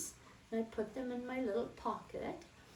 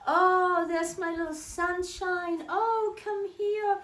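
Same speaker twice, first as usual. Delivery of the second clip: put-on much higher and louder, gushing old baker lady; sweet old lady greeting; 9 semitones higher, 6 dB louder than usual. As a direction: coo over the little girl with delight. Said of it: "She always said".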